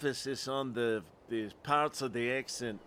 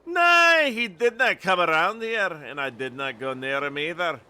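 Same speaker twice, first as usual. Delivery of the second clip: put-on Scottish accent; 3 semitones higher, 7 dB louder than usual. Lookout denying contact with stranger, Scottish accent. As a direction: deny it firmly in put-on Scottish. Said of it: "if my Scottish accent is bad".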